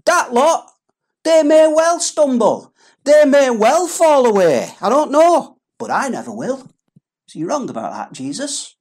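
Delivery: a touch of a swaggering Peter; cocky Peter. Acted. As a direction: brag to Jesus like a know-it-all. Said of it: "what Peter's actually saying is".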